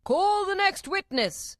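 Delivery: high, loud, drawn-out summons; royal call. A narrator's reading. King summons the next witness, loud royal call. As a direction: call it out across a courtroom, slowly.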